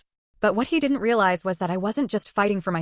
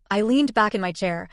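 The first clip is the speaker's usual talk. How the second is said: plain reading voice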